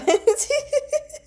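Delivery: squealing high giggle; real high-pitched giggle; high laugh. Real, not acted. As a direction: burst out laughing at the joke. Laughing at joke.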